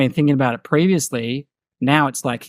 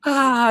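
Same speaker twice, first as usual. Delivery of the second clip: mock panicked gasp; 12 semitones higher than usual. Panicking parent in retold video.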